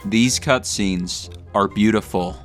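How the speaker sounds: ordinary talk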